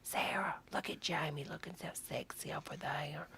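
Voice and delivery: gossipy whisper. Pretend. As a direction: whisper it behind a hand. Betty gossiping to Sarah.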